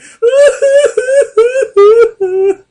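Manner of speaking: long, loud falsetto boo-hoo sobbing; high-pitched fake crying in pulsing wails; imitated bawling. Showing how the actor sounded the whole time, imitated bawling. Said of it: "He just sit there going".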